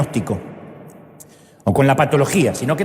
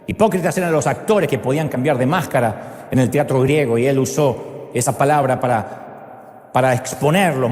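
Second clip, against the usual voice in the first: usual speaking voice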